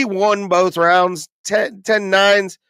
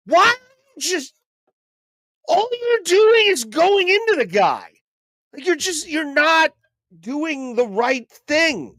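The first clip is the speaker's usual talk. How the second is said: much higher, strained telling-off; exasperated scolding; 11 semitones higher than usual. Real, not acted. Streamer scolding the fighter on screen, exasperated high-pitched scolding. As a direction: scold the fighter like he can hear you.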